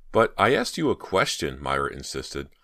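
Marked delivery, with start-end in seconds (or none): 0.1-1.6 a hint of whine, a little louder; whiny. A narrator's reading. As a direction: insist to her mom, mildly whining. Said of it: "not meaning to sound so whiny".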